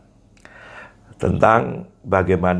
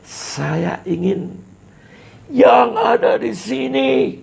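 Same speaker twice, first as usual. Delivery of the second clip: sudden high shout on "yang ada di sini"; emphasis demo; 13 semitones higher, slower than usual. Shown as a demonstration. Teacher demonstrating stress by calling out to the audience. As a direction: punch "yang ada di sini" with a far higher, shouted call. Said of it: "jadi penekanan".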